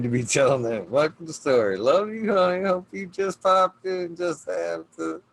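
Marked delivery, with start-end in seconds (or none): 1.8-5.2 higher, louder greeting through laughter; laughing as he repeats the hello to his daughter. Real, not acted. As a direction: say it again, half laughing.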